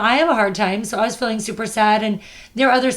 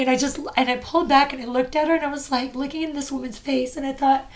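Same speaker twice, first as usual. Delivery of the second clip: ordinary talk, nothing marked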